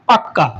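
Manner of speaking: পাক্কা with a held double k; ক দ্বিত্ব করে পাক্কা; doubled k. Shown as a demonstration. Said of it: "এরকম দ্বিত্ব করে থাকি ব্যঞ্জনের".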